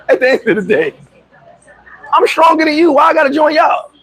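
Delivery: high, insistent retort; indignant boast. Retold or imitated. Telling the gang he needn't join, indignant. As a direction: throw the invitation back, pitched right up.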